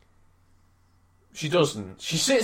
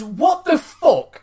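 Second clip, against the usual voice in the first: exasperated; 7 semitones higher, much slower than usual. Retold or imitated. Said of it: "I says".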